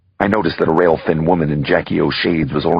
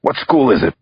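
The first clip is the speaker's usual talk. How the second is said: crying out; 8 semitones higher, 3 dB louder than usual. Acted. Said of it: "I cried".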